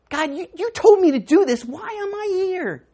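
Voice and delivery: loud, high cry to God; a desperate plea flung upward; anguished cry to God. Acted. Worker crying out to God in despair, loud.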